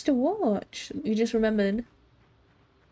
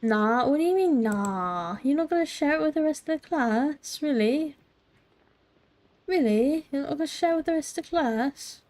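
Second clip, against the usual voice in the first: mock outrage; 4 semitones higher than usual.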